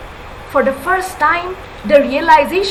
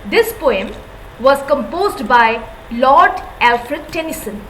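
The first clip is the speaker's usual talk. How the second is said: ordinary talk, nothing marked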